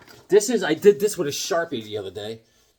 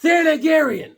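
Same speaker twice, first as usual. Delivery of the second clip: much higher, louder word; emphatic correction; 13 semitones higher, 7 dB louder than usual. Retold or imitated.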